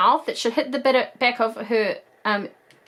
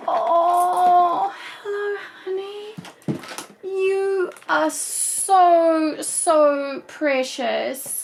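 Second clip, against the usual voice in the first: cooing to a doll; 6 semitones higher, much slower than usual. Real, not acted. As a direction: dote on her like a newborn.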